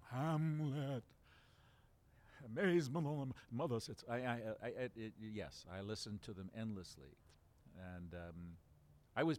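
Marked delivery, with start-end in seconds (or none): 0.1-4.0 loud, higher declaiming Shakespeare voice; Burton's Hamlet. Retold or imitated. Fan performs Burton's Hamlet, loud and grand. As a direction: boom it out like Burton on stage. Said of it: "I had Burton's Hamlet".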